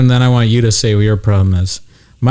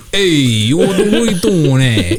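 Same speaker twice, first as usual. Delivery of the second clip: mocking Irish accent; 6 semitones higher than usual. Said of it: "You know how the Irish are".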